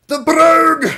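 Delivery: brogue shouted high and loud with a Scottish roll. Shown as a demonstration. Streamer belts the word in a high, loud Scottish accent. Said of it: "the Scottish brogue".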